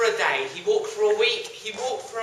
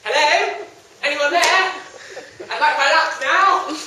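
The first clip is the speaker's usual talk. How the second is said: calling out; 6 semitones higher, 6 dB louder than usual. Acted. Young man calling out.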